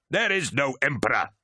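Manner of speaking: high, vehement denial; narrated shout. A narrator's reading. Commander denying the Emperor, shouted. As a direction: deny it with contained fury. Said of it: "shouted Turnit".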